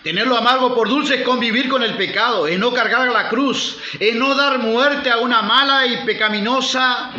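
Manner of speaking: insistent preacher's denunciation; forceful preaching. Real, not acted. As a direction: drive each point home to the congregation.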